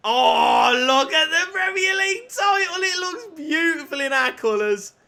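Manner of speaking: high excited shout at the trophy; awed shout. Real, not acted. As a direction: marvel at the title, voice soaring. Admiring the trophy.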